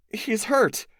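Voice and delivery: high urgent cry of worry; alarmed. A narrator's reading. Cassie seeing the burn on the alien, high alarmed cry. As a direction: call out in alarm over a wound.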